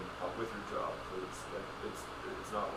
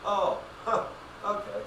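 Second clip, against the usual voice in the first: much higher, louder taken-aback; mock stunned; 10 semitones higher, 5 dB louder, slower than usual. Customer stunned by the AI's refusal, high and taken aback. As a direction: sound caught off guard.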